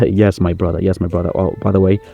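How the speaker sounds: usual speaking voice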